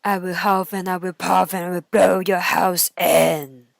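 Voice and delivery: low, loud growling threat; booming wolf at the door; big bad wolf. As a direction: blow the house down with your voice.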